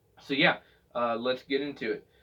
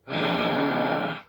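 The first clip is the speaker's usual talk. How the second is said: pushed sigh. Shown as a demonstration. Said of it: "I was using the sigh".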